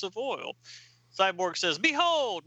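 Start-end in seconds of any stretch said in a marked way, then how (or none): 1.8-2.4 grand announcement. Acted. Cyborg grandly unveils his stash.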